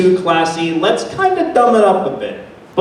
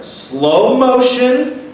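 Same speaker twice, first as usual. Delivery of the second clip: slow motion; 4 semitones higher, much slower than usual. Shown as a demonstration.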